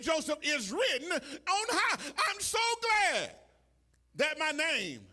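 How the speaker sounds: loud, high sermon shouting; preaching at full force. Acted. Pastor whooping to his church, shouting. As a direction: whoop it up to the congregation.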